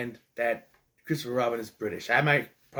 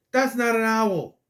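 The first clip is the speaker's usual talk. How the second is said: exasperated; 7 semitones higher, 4 dB louder than usual. Real, not acted. Ranting about owl design.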